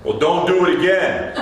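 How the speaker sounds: imitated New Jersey accent; Ronnie. Coworker objects to prayer before meal, New Jersey accent. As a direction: warn him off in a put-on New Jersey accent. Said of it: "in his thick New Jersey accent".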